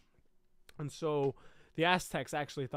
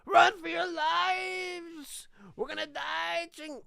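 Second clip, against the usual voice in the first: put-on screaming, high, slow panicked Mexican accent; 6 dB louder, slower than usual. Fleeing Aztecs screaming to each other, Mexican accent panic. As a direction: shriek the warning in a Mexican accent. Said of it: "doing my best to do a Mexican accent".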